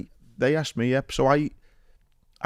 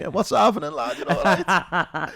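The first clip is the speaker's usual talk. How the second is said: higher, laughing mock Scouse greeting; Scouse accent; 7 semitones higher, slower than usual. Host mimics the Scouse guest walking past him, in a mock Scouse accent. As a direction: imitate a cheeky Scouser saying hello. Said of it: "I heard your Scouse accent".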